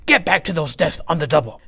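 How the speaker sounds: loud, fast barking orders; stern loud command. Acted. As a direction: bark orders like a drill teacher. Swamp bossing.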